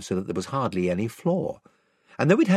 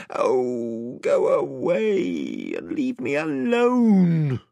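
put-on slow, sulky growl of a sick boy; 3 semitones higher, much slower than usual. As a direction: drag it out, grumbling and fed up. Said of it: "growled Eustace".

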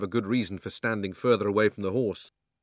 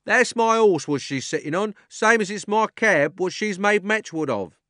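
high, loud rough cabman calling out; cockney cabby shouting; 11 semitones higher, 9 dB louder than usual. A narrator's reading. Cabby shouts over the crowd at the policeman, high and loud. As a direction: bellow at the policeman like a London cabby. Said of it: "he said".

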